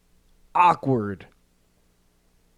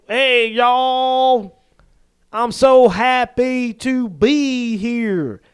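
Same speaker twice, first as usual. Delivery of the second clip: high, very slow, syrupy mock Southern drawl; Brian Kelly's fake Southern accent; 5 semitones higher, much slower than usual. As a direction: overdo a Southern accent, dragging out each word. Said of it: "trying to pull off a Southern accent".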